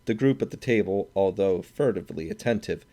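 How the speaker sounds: plain reading voice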